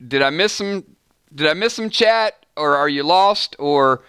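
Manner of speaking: ordinary talk, nothing marked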